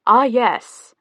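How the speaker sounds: grand, cheerful calling out. A narrator's reading. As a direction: call out brightly to the next room. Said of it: "Julian called cheerfully from their miniature kitchen".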